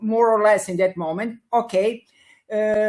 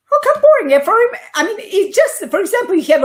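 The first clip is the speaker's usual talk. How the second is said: much higher and louder, incredulous; indignant disbelief; 12 semitones higher, 8 dB louder, much faster than usual. Real, not acted. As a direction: shoot up in disbelief at the question. Indignant at "isn't it boring.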